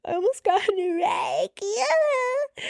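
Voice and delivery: very high, squeaky mock wail; starting to cry. Daughter blurting her near wreck to mom, wailing. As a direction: imitate yourself bawling, hammed up. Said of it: "now starting to cry".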